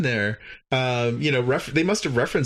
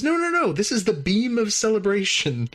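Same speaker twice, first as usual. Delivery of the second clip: higher, eager voice of a cheerful host; mock alien host; 8 semitones higher than usual. Recapping the episode, voicing the host correcting the crew, higher and eager. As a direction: play the happy alien host, lightly.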